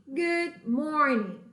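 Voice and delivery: very slow, clear Good morning; slow model phrase. Shown as a demonstration. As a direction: draw it out for students to repeat. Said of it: "Repitam aí". (bem devagar para repetir)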